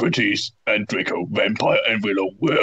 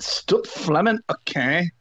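mocking author voice; 6 semitones higher, slower than usual. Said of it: "I said".

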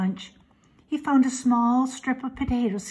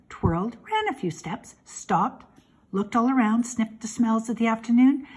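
plain reading voice

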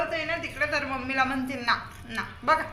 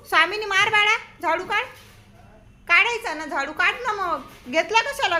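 high encouraging; 6 semitones higher, 3 dB louder than usual. Real, not acted. Urging baby to sweep.

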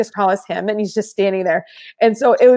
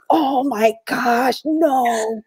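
slow, drawn-out dismayed groan; imitating Tony's dismay; 3 semitones higher, much slower than usual. Tony hearing the sneaky plan, slow dismayed. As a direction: stretch it out like a friend in disbelief. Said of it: "He does that".

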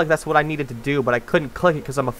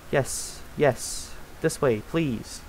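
soft, slow please; hushed pleading; 11 dB quieter, slower than usual. Real, not acted. Gamer quietly begging the banana to go this way, soft and slow. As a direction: coax it under your breath.

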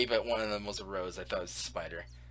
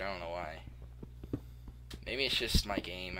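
ordinary talk

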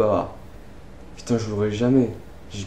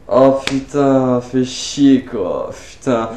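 slow frustrated groan; 4 semitones lower, much slower than usual. Real, not acted. After dying.

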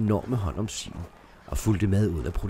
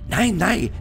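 calling out; 9 semitones higher, 6 dB louder than usual. Acted. Widow calls down from window.

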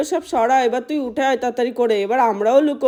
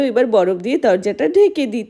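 ordinary delivery

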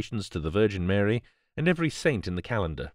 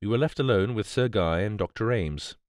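ordinary delivery, nothing marked

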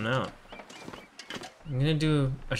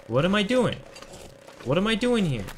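exasperated; 7 semitones higher, 3 dB louder than usual. Real, not acted. Finds nothing, frustrated.

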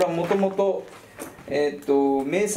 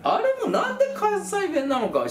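laughing. Real, not acted. Laughing about Kansai dub.

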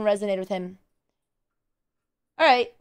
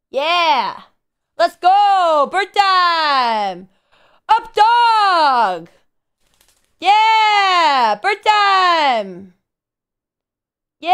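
high, drawn-out hype calls; bro talk; 5 semitones higher, 5 dB louder, much slower than usual. Pretend. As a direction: hype up the bird like a loud bro. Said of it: "he likes bro talk better".

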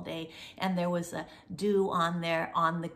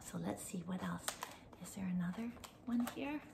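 soft whispered aside to herself; 13 dB quieter, faster than usual. Real, not acted. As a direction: whisper it as if searching quietly. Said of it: "whispering to herself while searching".

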